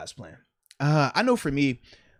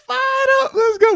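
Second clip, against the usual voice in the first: much higher, louder hype yell; excited shout; 9 dB louder than usual. Real, not acted. Host cheering his cohost's cheese pack, excited shout. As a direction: pump the whole crowd up.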